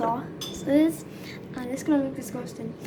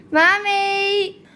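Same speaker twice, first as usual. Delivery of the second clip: calling out; 6 semitones higher, 8 dB louder than usual. Real, not acted. Calling mom in from another room.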